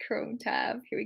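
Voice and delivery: whispered aside while clicking; whispering. Real, not acted. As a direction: murmur it in a whisper. Whispering screen-share steps to herself.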